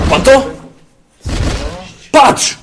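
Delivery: very high, loud battle yells; frantic high yelling as the enemy attacks; panicked shouting. Real, not acted. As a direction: scream out orders in a firefight. Firefight in game.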